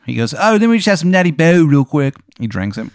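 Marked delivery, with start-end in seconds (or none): 0.3-2.1 high, loud, hammed-up Maryland accent; a bad Maryland accent pitched way up; overdone Maryland accent, loud and high; terrible Maryland accent. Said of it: "His accent is so bad".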